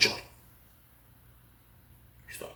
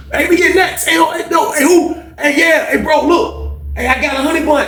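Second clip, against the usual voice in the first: block-wide shouting. Retold or imitated. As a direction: call out over everyone for food. Whole block shouting about food.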